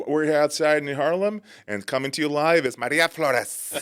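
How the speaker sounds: radio reporter. Retold or imitated. Reporter signing on live from Harlem, switching to Spanish pronunciation. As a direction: report live, then roll the name in Spanish. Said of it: "that one reporter would always do that".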